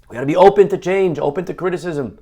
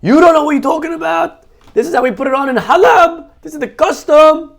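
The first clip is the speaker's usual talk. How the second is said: much higher, loud angry retort; yelling back; 13 semitones higher, 6 dB louder than usual. Retold or imitated. Old man yelling back that this is the custom in Halab, loud angry retort. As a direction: fire back indignantly at the critic. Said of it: "He started yelling back".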